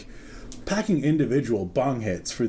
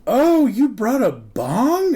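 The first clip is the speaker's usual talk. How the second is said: high, loud, slow and amused; a boss caught off guard, laughing; laughing disbelief from the boss; surprised boss; 10 semitones higher, 6 dB louder, slower than usual. Retold or imitated.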